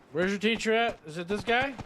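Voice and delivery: calling out for the teacher, hyped; eager calling out. Real, not acted. Streamer searching for the teacher, loud and excited.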